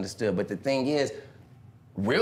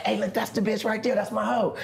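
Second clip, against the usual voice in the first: higher, proud show-off; 7 semitones higher than usual. Retold or imitated. To his friends in the car, proud show-off. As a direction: point her out with pride. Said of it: "I say".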